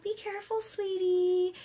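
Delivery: high, sugary kind-mother voice; sweet mom voice. Retold or imitated. Imitating how a kind mother would speak to her little girl. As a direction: coo it like a gentle mom.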